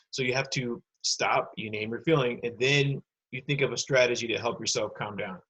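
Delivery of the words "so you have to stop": normal talking voice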